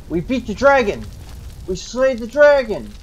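loud, high triumphant exclamation; victory cry. Acted. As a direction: cheer the kill at the top of a breath. Cheering the dragon's defeat.

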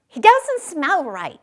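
high, loud alarmed exclamation; exclaiming. A narrator's reading. Wild rabbit exclaiming after sniffing him, high and loud. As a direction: blurt it out in alarm. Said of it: "he exclaimed".

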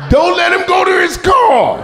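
high, loud panicked warning; hammed-up warning to the crowd; mock alarm shout. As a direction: yell a fake alarm.

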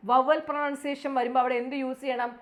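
normal talking voice

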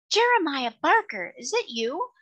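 high-pitched, delighted disbelief; surprised recognition. A narrator's reading. Lady recognises an old acquaintance.

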